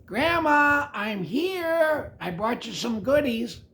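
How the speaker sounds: put-on high, bright child calling out to Grandma; a cheerful girl arriving with goodies; little girl voice.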